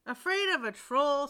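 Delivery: scoffing father. Acted. Father mocks his frightened second son, scoffing loudly. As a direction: scoff at the son's fear. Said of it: "scoffed his father".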